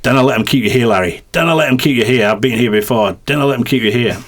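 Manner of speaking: put-on lightly done Scottish accent; a hint of a Scottish mate pleading. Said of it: "excuse my Scottish accent".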